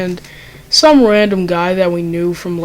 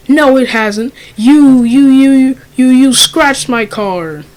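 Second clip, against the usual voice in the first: louder, higher stammered accusation; accusing Karen; 4 semitones higher, 5 dB louder than usual. Retold or imitated. Angry customer accusing the car wash kids, a loud stammering rant. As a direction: jab each you at them, indignant.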